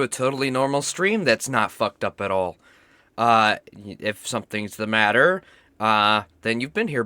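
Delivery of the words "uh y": normal talking voice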